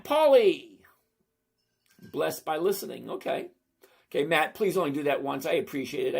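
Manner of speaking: ordinary delivery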